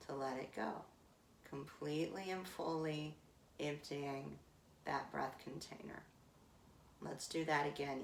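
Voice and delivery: pace the class through each sip of air; guided breath cues. Acted. Yoga teacher cueing the class through Viloma breath, calm guiding voice.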